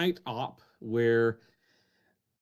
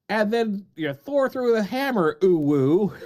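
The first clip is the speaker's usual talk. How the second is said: lightly mimicked epic storytelling, higher and a bit louder; mocking his monologue; 6 semitones higher, 5 dB louder than usual. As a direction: tease him with a hammy retelling of Thor. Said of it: "mental monologue for you".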